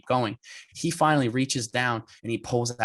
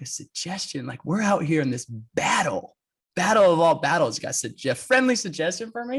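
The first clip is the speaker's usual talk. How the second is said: incredulous; 5 semitones higher than usual. Retold or imitated. Racer scoffs at his rival's friendly advice, incredulous. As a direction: throw the offer back, indignant.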